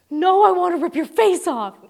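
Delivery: playful fake anger through a laugh; mock rage. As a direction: ham up wanting to yell back. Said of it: "I just wanna yell".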